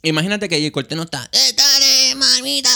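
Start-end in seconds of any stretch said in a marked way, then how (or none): 1.3-2.7 high, loud singer impression; imitating the singer. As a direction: toss it off like a reggaeton ad-lib. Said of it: "Es él, es él, es Fate".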